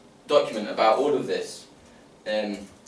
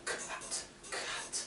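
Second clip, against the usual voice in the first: speeding up; 16 dB quieter, much faster than usual. Shown as a demonstration. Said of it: "let's say that faster".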